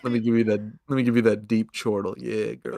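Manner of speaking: mock seductive murmur; sultry deep voice. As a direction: purr it like a lover, dropped low.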